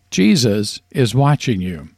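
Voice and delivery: ordinary delivery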